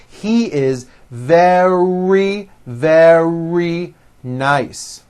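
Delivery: both words "very" stretched on a long middle R; word by word, pushing out the R in "very"; slow, strong R. Shown as a demonstration. Practice these sentences with me, slowly, "very" with a long strong R. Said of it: "nice and slow".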